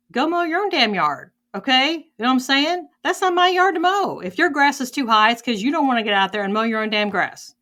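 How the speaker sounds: high, loud, exasperated comeback; indignant retort. Acted. Telling off a neighbor who blames her for the grass, indignant.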